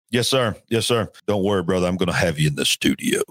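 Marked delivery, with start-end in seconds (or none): 1.3-3.2 playful deep radio announcer voice; put-on low broadcaster voice. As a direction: drop low into a mock radio-host voice. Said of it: "That's my radio voice".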